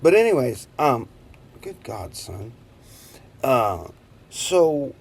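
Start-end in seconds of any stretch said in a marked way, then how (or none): none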